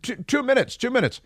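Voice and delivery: high pleading mock voice; imitating himself begging the officer for two minutes; sheepish plea. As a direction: squeak it out like a caught driver.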